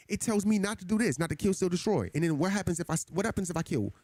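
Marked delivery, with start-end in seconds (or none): none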